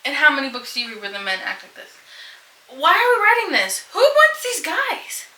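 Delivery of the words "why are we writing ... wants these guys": exasperated disbelief. Real, not acted. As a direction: throw up your hands in disbelief. Outraged rant against romance heroes.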